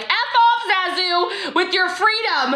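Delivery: high, laughing mock yell; laughing fake rage at Zazu the bird. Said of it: "screaming at Zazu".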